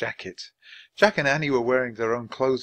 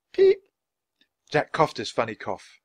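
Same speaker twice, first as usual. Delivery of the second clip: ordinary delivery, nothing marked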